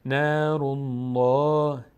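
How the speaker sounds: نار الله chanted slowly and loud with a thick, dark ll; full, heavy lam. Shown as a demonstration. Teacher applying the damma rule, full heavy lam in الله.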